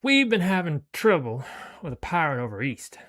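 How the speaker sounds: smug thug complaint; Skinny's voice. Acted. Retake of Skinny's line.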